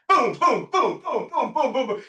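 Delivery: punchy volley of imitated booms; quick percussive boom sounds; rapid-fire booms. Imitating rapid-fire questions and answers.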